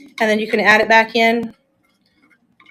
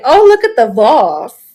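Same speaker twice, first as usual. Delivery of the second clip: louder, hammed-up bougie admirer; mock bougie; 6 dB louder than usual. Showing viewers why it is not their genre, mock bougie. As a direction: play snooty, drawing out vase as VAHSS. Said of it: "vase is too bougie".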